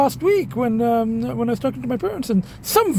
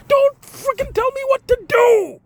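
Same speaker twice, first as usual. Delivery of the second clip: high, louder indignant snap; real irritation, pitched high; indignant outburst; 13 semitones higher, 4 dB louder than usual. Real, not acted. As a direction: throw the line back at them. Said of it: "I'm just really pissed off".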